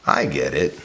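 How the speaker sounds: low, musing realisation; in thought. Shown as a demonstration. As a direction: say it as if thinking it through. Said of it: "in thought".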